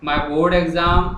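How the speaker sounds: very slow, word by word; slow dictation. Shown as a demonstration. Teacher writing the letter on the board, slow dictation. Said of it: "अब मैं लिखना शुरू कर रहा हूँ".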